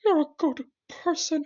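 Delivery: very high, loud squeaky creature; put-on tiny cartoon voice; little shrimp voice. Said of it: "And the shrimp says".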